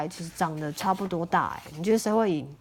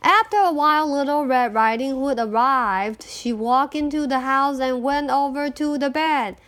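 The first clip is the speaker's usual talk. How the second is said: plain reading voice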